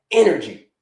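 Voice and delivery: "energy" alone, punched loud; stress on energy. Real, not acted. Coach naming what the body craves, loud stress on "energy". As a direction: land hard on the single word "energy". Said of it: "I'm gonna keep emphasizing the word energy".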